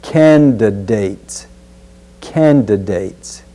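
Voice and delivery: "candidates" slow, with a clear d before the final s; clear d sound. Shown as a demonstration. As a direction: say "candidates" carefully, keeping the d. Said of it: "Be sure to get that first d sound".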